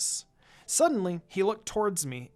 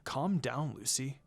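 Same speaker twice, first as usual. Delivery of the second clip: quiet whisper; 5 semitones lower, 6 dB quieter than usual. A narrator's reading. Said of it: "Sasuke whispers quietly".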